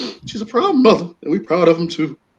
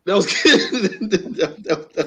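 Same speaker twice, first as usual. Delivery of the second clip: slow, laughing praise; amused laugh over the words; 5 semitones higher, much slower than usual. Real, not acted. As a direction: chuckle it out slowly. Laughing at friend's joke.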